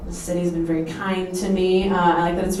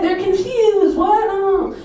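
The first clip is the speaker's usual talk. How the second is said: mock confusion; 10 semitones higher, slower than usual.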